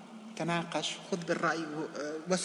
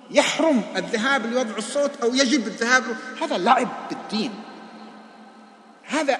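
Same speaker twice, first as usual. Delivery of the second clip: loud, rising sermon, higher and louder; preaching at full force; 7 semitones higher, 9 dB louder than usual. Acted. Imam warning worshippers against political pulpits, fiery loud preaching. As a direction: thunder the warning at the congregation.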